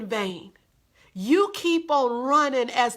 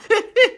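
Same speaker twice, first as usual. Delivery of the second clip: short, high, loud chuckle; amused laugh; 10 semitones higher, 7 dB louder than usual. Real, not acted. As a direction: burst out laughing briefly. Laughs at repeated phrase.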